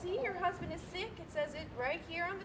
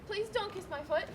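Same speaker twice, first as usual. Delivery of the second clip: normal talking voice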